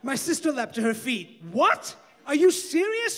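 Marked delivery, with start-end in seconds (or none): 1.5-3.2 high, loud squeal of disbelief. Acted. Daughter to her father, squealing in high, loud disbelief. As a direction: shoot up high and loud, shocked. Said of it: "she squealed".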